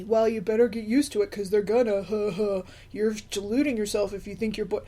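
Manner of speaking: mimicked naysayers, lightly mocking; mock the know-it-all commenters; mocking imitation. Naysayers warning a parent about the boys, mockingly imitated. Mimicking people who dismissed her post.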